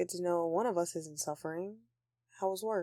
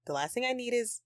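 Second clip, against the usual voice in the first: usual speaking voice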